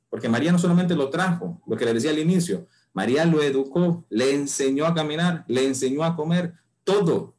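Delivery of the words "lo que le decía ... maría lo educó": ordinary delivery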